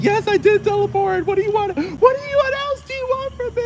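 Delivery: high shrill mock sobbing mom; mock crying mom. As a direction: wail it like a dramatic mom. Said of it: "She'll probably start crying".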